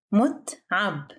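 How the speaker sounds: "متعب" split into two syllables with a gap. Shown as a demonstration. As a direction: pause midway through "متعب". Vocabulary drill, broken into syllables.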